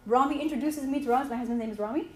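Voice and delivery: ordinary delivery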